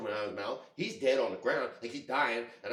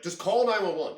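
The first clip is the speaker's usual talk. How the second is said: urgent order; 6 semitones higher, 7 dB louder than usual. Retold or imitated. Urgent order to panicking partner.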